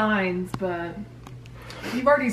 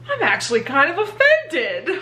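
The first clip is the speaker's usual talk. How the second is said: mock offended; 8 semitones higher, 5 dB louder than usual. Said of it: "His exact words were".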